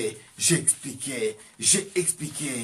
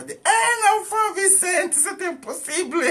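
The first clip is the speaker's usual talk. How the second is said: much higher and louder, stunned disbelief; astonished; 7 dB louder than usual. Retold or imitated. Sick cousin told she can bear a child, astonished cry. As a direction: gasp it out in disbelief.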